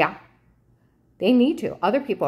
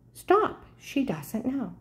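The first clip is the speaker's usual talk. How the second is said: mock warning; 7 semitones higher, much slower than usual. Quoting agent's mocking warning.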